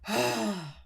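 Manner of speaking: heavy exhale with a hint of growl; put-on angry huff; angry sigh demo. Shown as a demonstration. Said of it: "How do you produce that kind of sigh?".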